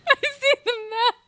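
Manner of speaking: said through laughter, fast; laughing. Real, not acted. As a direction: laugh while repeating it.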